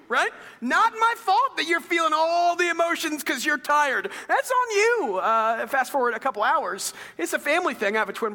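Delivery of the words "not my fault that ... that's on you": put-on high, loud teasing scold of a toddler; hammed-up lecture to a crying baby; mock scolding. Dad mock-scolding his tired daughter, high and loud. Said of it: "I'm gonna keep saying that to her".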